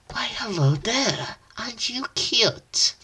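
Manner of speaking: high breathy cooing voice; cooing whisper. Acted. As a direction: coo at her like a pet. Creature coos at hero.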